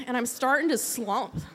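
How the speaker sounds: ordinary talk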